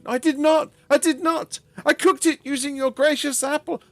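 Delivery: high, pleading voice; begging. Acted. Tulia begs the trader that she is innocent, high and pleading. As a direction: beg him to believe you. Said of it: "as Tulia begged".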